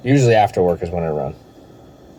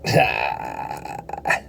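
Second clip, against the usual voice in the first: low, creaky laugh; amused laugh; 8 semitones lower, 5 dB quieter than usual. Real, not acted. Reacting after that one fell, amused low laugh.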